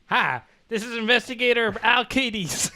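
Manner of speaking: a drawling Southern investigator. Acted. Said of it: "No, he's not Southern Newsies".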